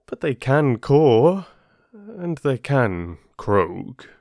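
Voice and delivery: usual speaking voice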